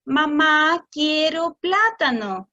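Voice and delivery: slow model. Shown as a demonstration. Adult repeating child's request back, slowly and clearly. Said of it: "Lo que el adulto debería hacer es decir".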